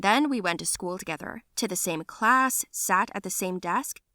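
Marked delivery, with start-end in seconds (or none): none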